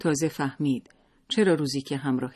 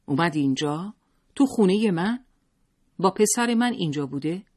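angry questioning; 4 semitones higher, 4 dB louder than usual. A narrator's reading. Husband's angry interrogation.

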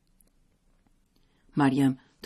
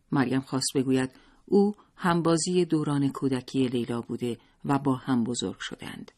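usual speaking voice

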